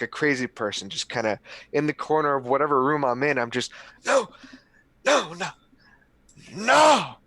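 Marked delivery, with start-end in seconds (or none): 4.0-7.1 high, loud snarled outbursts; crazed shouts building to a peak; repeated snarl. Acted. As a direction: snarl each one like a crazy person. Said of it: "I just snarl".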